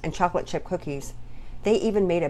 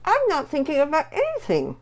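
high voice talking through a mouthful; words round a bite of candy; mouth full; 10 semitones higher, 4 dB louder than usual. Acted. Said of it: "Mama's mouth was full".

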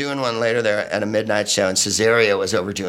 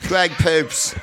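mock club announcer; 6 semitones higher, slower than usual. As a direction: play a bored club host brushing him on. Announcer introducing the comic.

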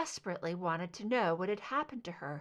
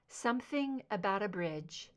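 plain reading voice

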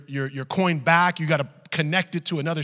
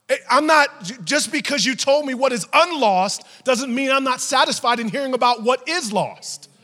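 full-force preaching; 9 semitones higher, 8 dB louder than usual. Acted. Preacher voicing a mother prioritizing her lost child, full force. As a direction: hammer it home as the frantic mother.

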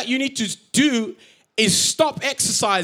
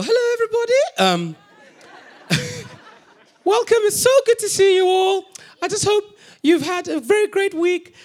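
much higher, sing-song welcome like a gym instructor; high-pitched instructor voice; 11 semitones higher than usual. Retold or imitated. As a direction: put on a sweet, high fitness-instructor voice. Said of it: "she sounds something like this".

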